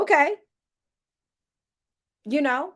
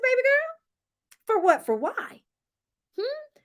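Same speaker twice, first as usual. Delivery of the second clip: mock teasing; 10 semitones higher, slower than usual. Real, not acted. Vlogger teasing a cast member, slow high sass.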